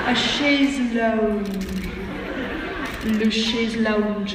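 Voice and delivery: mangle it in a terrible French accent; bad French accent. Shown as a demonstration. At a con panel, mocking her own chaise lounge in a terrible French accent. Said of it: "That's the worst French accent you've ever heard".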